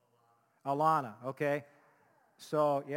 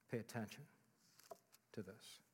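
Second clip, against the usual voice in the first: hushed instruction; 5 semitones lower, 16 dB quieter than usual. Shown as a demonstration. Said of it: "that's what we need to do".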